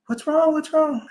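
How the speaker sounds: a nurse startled, asking fast what's happening; alarmed nurse. Retold or imitated. Nurse hears the monitor race and asks the patient, alarmed.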